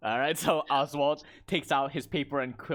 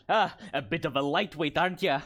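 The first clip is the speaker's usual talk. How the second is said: Scottish-tinged Goliath, pitched a little high; Scottish accent; 4 semitones higher, 4 dB louder than usual. Acted. Goliath woman ribbing the fainted drinker, Scottish accent. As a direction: tease in an accidental Scottish lilt. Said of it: "Goliath with a Scottish accent".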